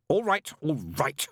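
slow, clipped snapping repeat; a demon snapping at praise; irritated snap. Acted. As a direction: cut him off, annoyed. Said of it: "Crowley snapped".

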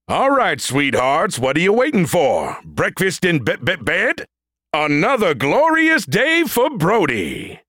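high booming android drill-sergeant voice; shouted greeting. A narrator's reading. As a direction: bellow it like a cocky robot sergeant. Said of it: "shouting a greeting to the rangers".

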